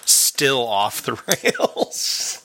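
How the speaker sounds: very slow, drawn-out title reveal; mock title announcement. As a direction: stretch the last word like a show title.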